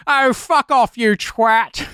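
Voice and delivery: high-pitched offended British man; Alan Partridge-like. Retold or imitated. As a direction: put on an affronted British voice. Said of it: "Alan Partridgey, British guy offended".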